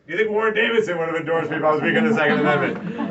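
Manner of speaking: high, forceful rally voice; rousing challenge. Real, not acted. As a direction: hammer the question at the crowd. Rhetorical question building to a climax.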